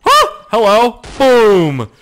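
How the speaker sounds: sudden loud high huh, then a loud boom; spring it on them and yell boom; gleeful gamer taunt; excited taunt. Real, not acted. Surprising an enemy before shooting.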